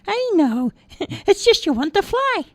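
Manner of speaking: very high squeaky little voice; chirpy tiny elf; tiny man voice. Acted. Tiny man greets crying Roger, squeaky high.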